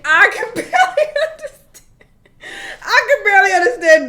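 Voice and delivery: high, loud words through a laugh. Real, not acted. Admitting she can't understand Duke, laughing. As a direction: laugh right through the line. Laughing about thick accents.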